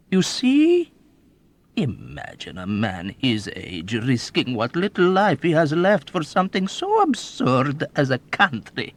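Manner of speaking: put-on high, loud, gleeful old man's boasting; old rogue bragging to a young officer; sly old man. A narrator's reading. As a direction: relish every wicked memory.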